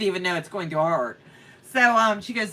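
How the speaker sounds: usual speaking voice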